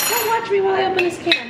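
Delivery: quick, high, mock-pleading; playful high exclamation. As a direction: blurt it out high and fast. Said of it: "I feel like saying".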